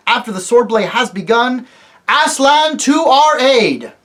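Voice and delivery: high, loud battle orders; a king placing his troops before the charge; king commanding battle. Acted. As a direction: shout the line into position. Tyrion commanding the battle line.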